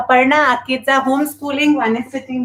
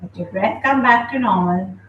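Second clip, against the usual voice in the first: calm, unhurried class guidance; yoga instruction. Acted. Yoga teacher guiding her online class, calm instruction. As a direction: guide the class gently through the breath. Leads a breathing session.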